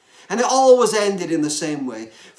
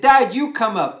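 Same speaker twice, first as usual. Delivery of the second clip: put-on child calling out, a little louder; mock child shout; 3 semitones higher, 6 dB louder than usual. Boy calling his father from bed, mild mock shout. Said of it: "he shouted down again".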